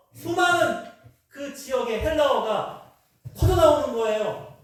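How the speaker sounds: high-pitched, forceful sermon delivery; impassioned preaching. Real, not acted. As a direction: preach it with rising intensity, pitched well up. Preacher builds to a climax.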